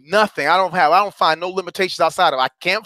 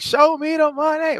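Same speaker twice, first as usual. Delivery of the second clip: movie line; 10 semitones higher than usual. Retold or imitated. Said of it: "He was like".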